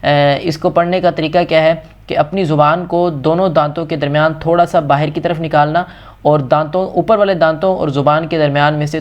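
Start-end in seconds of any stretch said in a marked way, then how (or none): none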